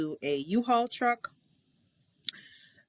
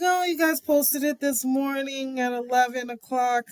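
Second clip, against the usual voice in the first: eager self; 7 semitones higher, 3 dB louder than usual. Retold or imitated. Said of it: "I'm like".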